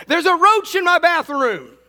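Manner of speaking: high, loud mock shriek; shrill cry of panic, hammed up; imitated shriek. Easton runs in to report a roach, mock shrieking. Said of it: "she hears this shriek".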